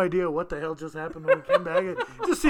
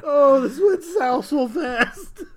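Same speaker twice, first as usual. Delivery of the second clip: slow, high words through laughter; helpless laughter; 7 semitones higher, 4 dB louder, much slower than usual. Real, not acted. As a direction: wheeze it out while laughing. Laughing at the accent bit.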